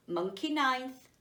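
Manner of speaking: plain reading voice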